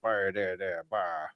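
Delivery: low, soft Cajun mumble; mock Cajun cook. Host imitating the Cajun cooking man, low Cajun mumble. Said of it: "who's the Cajun cooking man?".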